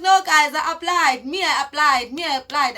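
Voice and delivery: loud, repeated mock protest; insistent protest. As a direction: insist loudly, acting out the complaint. Acting out protest at the department.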